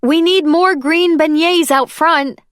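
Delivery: high, loud call to the kitchen, slow. A narrator's reading. Piper hurrying into the kitchen, calls out loud and high. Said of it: "called Piper".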